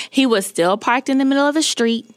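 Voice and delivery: usual speaking voice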